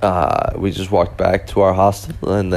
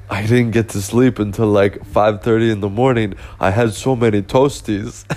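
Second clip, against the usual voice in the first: put-on German accent; 2 semitones higher than usual. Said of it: "he had this thick German accent".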